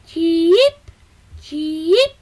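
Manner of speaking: high chirping chick voice; hungry baby bird. A narrator's reading. Baby sparrow begging his parents for food, high chirps. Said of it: "roept Oelekwie".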